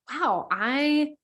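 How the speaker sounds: high, playful wonder; lightly hammed-up self-praise; mock self-admiration. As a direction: gush at yourself, half-joking. Voicing self-admiration, listener laughs.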